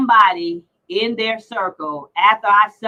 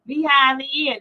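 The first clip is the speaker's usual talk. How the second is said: mock woman's voice; 4 semitones higher, slower than usual. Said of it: "And she went".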